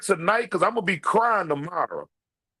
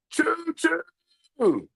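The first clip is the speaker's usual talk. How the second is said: high shouted train whistle; playful shouted train call; choo choo; 9 semitones higher than usual. Pretend.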